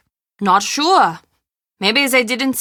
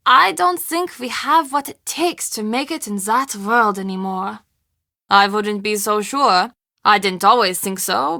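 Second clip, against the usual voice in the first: normal talking voice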